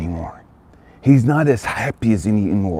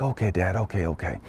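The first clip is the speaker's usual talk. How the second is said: put-on disappointed boy; 7 dB quieter than usual. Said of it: "Little boy's like".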